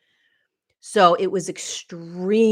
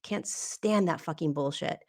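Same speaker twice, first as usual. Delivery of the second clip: ordinary delivery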